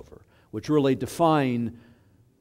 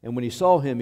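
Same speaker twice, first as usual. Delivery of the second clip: ordinary delivery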